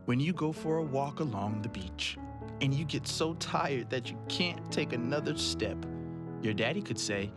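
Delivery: plain reading voice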